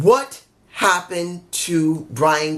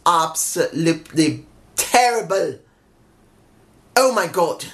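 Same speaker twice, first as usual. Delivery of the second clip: slipping into a rough British accent; accidental British accent. Real, not acted. Wrestling reviewer complaining about camera angles, slipping into a British accent. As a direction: let a British-sounding accent creep in. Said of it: "I'm sounding like a British person".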